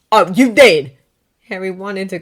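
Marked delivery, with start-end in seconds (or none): 0.1-0.9 loud accusing retort; angry retort. Acted. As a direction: throw it back at him.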